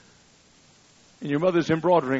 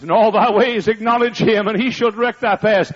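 louder, higher preached "In all thy ways acknowledge him"; 8 semitones higher, 9 dB louder than usual. Shown as a demonstration. Preacher showing how he preached the verse, loud and ringing. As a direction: proclaim it from the pulpit. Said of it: "And I preached it".

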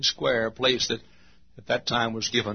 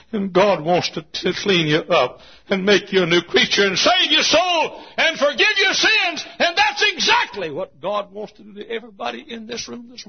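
loud, rising, high preaching shout; preaching at full force; 11 semitones higher, 7 dB louder than usual. Acted. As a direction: build to a fervent climax. Preacher climaxing the message.

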